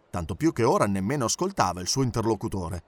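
plain reading voice

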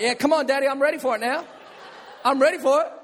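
mock-confident, daring taunt; cocky kid. Padded boy daring his dad to spank. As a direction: taunt dad, full of bravado. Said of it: "It's like".

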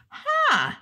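high, dopey huh; a clueless guy's blank huh; dumb guy huh. Retold or imitated. Imitating a clueless man's high huh.

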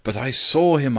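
high, loud, insistent objection; protesting cry. A narrator's reading. Narrator insisting to his running friend, loud protesting cry. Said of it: "I cried".

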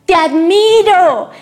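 slow, high, loud call; drawn-out hollered admiration; shouted praise. A narrator's reading. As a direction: shout it out across the mountains, stretched long. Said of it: "voy a gritar".

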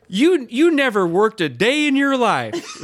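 put-on a good old boy joking with a stranger at the bar; teasing local. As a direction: rib him with a grin. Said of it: "he goes".

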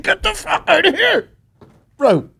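very high, squeezed mock shout; laughing mock outburst. Guest fed up with the host's bathroom tips, high laughing mock shout. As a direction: squeal it through laughter, hammed-up exasperation.

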